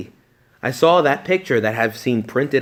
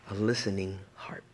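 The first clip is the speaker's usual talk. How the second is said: whispered; 3 semitones lower, 10 dB quieter than usual. Acted. Hushed climax of the story.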